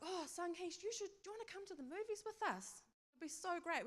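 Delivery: high-pitched, bubbly invite; eager invitation. Retold or imitated. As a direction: gush as you coax a friend along. Acted eager invitation to friend.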